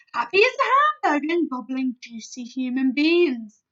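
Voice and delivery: loud, raging brute; ranting giant. A narrator's reading. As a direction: rant at the vegetarian giant. Bloodbottler ranting.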